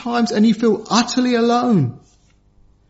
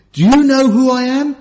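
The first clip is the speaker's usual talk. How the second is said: higher, louder haughty question; mock arrogant demand; 5 semitones higher, 4 dB louder than usual. Preacher mimics a proud man demanding respect, mock haughty. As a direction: play the offended big shot, punching you. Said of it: "did he ever once say to his people".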